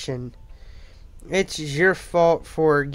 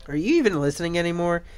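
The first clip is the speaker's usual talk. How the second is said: ordinary talk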